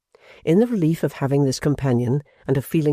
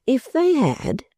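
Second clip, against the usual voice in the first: Pancks; 12 semitones higher, slower than usual. Acted. Pancks prompting again.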